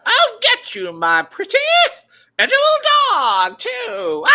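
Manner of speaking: high, loud screeching witch; Wicked Witch of the West. Acted. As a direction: shriek the threat like a storybook witch. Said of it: "do the Wicked Witch of the West".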